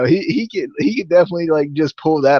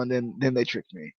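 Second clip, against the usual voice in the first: muttered; 3 semitones lower, 11 dB quieter than usual. Real, not acted. Repeats complaint, first muttered.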